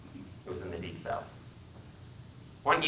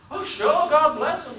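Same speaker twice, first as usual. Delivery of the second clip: much higher, louder Southern zeal; fervent Southern accent; 7 dB louder, slower than usual. Acted. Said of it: "His speech was the deepest South".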